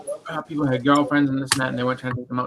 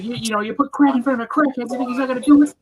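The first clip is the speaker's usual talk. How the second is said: higher and louder, a hammed-up scolding rant; mock indignant friend; 12 semitones higher, 5 dB louder, faster than usual. Friend scolding about the candy, in a mock indignant rant. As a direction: play the friend scolding over the candy, faster. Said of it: "He's like".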